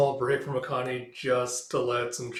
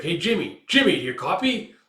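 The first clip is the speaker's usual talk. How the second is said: raised voice, higher, calling a soldier; calling out on the radio; 5 semitones higher, 6 dB louder than usual. Retold or imitated. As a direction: call out to Jimmy on the radio, a bit louder. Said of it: "It's like".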